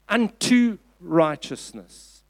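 UNTO drawn out and set apart before "righteousness"; stress on UNTO. Shown as a demonstration. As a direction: lean slowly on "unto", then pause. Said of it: "I want you to stress that word".

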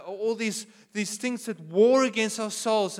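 ordinary talk

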